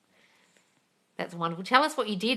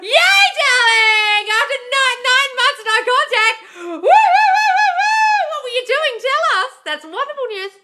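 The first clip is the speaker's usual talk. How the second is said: gasping, shrieking with joy, much higher and louder; real excited shriek; 14 dB louder than usual. Real, not acted. Host cheering a viewer whose ex came back, ecstatic shrieks. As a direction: scream the good news like a thrilled fan.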